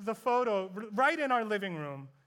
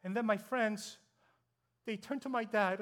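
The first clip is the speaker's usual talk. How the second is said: ordinary delivery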